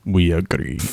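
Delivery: deep, loud, slow guttural voice; low, terse Bulgarian accent. Acted. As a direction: growl it like a scary, stout Bulgarian captain. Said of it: "Bulgarian language is very guttural".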